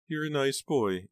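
high, slow, plaintive praise; grateful, fragile aunt. A narrator's reading. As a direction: thank him slowly in a high little voice. Bereaved aunt thanks the boy.

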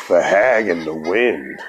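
low, slow puzzled echo of the hag in the wind, with hag leaned on; puzzled friend. Retold or imitated. A friend on the phone, puzzled at the hag in the wind, low and slow. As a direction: drop low and slow, mulling the strange name over. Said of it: "And he's like".

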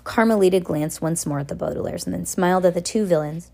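plain reading voice

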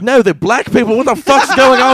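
high, very loud comic horror; over-the-top appalled yelling; mock outraged shout. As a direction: shout it in hammed-up disbelief. Acted outrage of resurrected founding fathers.

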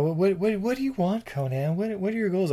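soft princess voice. Retold or imitated.

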